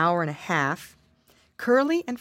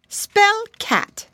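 high, loud teacher; ladybug teacher voice; 6 dB louder than usual. Acted. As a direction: quiz like a strict teacher. Teacher quizzes pig.

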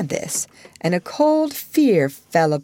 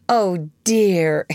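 remorseful exclamation; 3 semitones higher, 4 dB louder than usual. Acted. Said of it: "exclaimed Wendy".

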